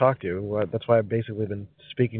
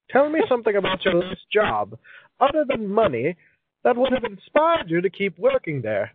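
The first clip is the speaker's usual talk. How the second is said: put-on high-pitched, theatrical old-timey reading; 8 semitones higher than usual. Host reading a question card in a high old-timey voice. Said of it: "read this in an old-timey voice".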